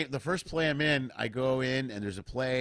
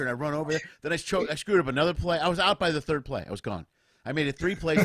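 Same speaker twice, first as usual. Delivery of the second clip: ordinary talk, nothing marked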